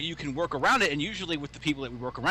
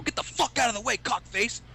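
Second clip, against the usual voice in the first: higher, barked insult at a stranger; mock angry yell; 6 semitones higher than usual. Stranger bumped into on a corner, mock yell. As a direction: snap at him like an offended passerby. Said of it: "and they go".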